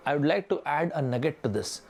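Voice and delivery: slow, deliberate group-discussion line; slow, polished delivery of a model answer. Shown as a demonstration. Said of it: "तो आपने बोला कि".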